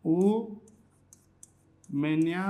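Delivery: very slow, each syllable stretched; dictating slowly while writing; drawn-out demo. Shown as a demonstration. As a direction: stretch the word out for students to copy. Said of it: "इसको बोलित नहीं बोलेंगे".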